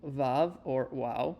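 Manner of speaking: "vav" then "wow" said slowly; vav and wow demo; slow letter names. Shown as a demonstration. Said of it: "Now the next one".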